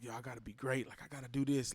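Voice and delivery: low, hushed pep talk to himself; quiet self-talk. Retold or imitated. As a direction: murmur it like a private vow. Inner voice pushing himself.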